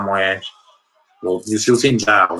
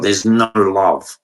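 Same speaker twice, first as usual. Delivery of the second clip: normal talking voice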